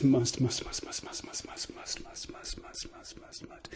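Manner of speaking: repeated words fading into a whisper. Acted. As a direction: let it trail off to nothing. Repeated word fading away.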